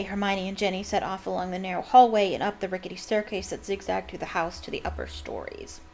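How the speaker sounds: ordinary delivery, nothing marked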